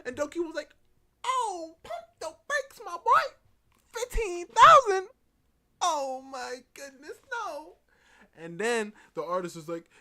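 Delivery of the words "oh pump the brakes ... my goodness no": loud, very high squawk of disbelief; hammed-up shock. Doki balking at fifteen thousand, loud high mock shock. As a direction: blast out mock outrage, then stretch the refusal.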